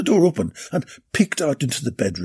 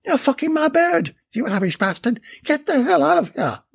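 furious shout; 12 semitones higher than usual. A narrator's reading. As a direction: scream at the man stealing your girl. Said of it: "shouted Des".

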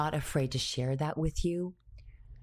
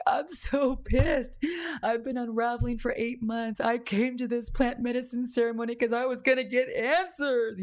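pissed and near tears, pitched up; retold frustration; 7 semitones higher than usual. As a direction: vent the old anger through tears. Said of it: "it was not funny then".

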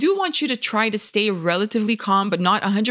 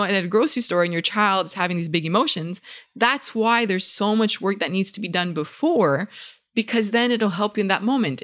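ordinary delivery

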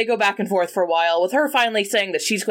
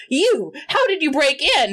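shocked accusation; 7 semitones higher than usual. Retold or imitated. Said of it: "she's like".

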